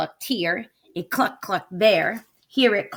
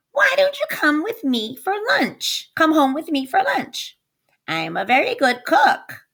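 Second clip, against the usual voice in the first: sweet-talking witch; 7 semitones higher than usual. Acted. Witch sweetly invites wolf.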